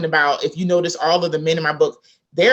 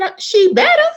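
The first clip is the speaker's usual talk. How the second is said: emphatic retort; 5 dB louder than usual. Retold or imitated.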